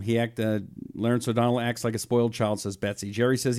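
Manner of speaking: normal talking voice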